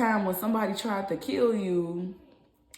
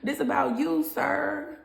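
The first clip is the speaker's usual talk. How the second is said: higher voice, laughing as you insist; amused, teasing call-out through laughter; real giggles breaking into the words; 7 semitones higher than usual. Real, not acted. Laughs while calling out the listener.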